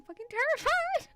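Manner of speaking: rising high in sudden fright; spooked. Real, not acted. Streamer creeped out by bad vibes, spooked. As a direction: voice climbing as the nerves hit.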